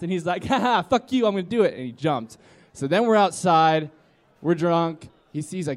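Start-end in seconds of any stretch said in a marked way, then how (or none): 0.4-1.7 high, loud acted bravado; mock defiance. Friend brushing off warnings before jumping, acted cocky defiance. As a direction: imitate a cocky daredevil.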